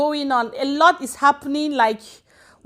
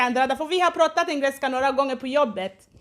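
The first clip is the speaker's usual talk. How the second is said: usual speaking voice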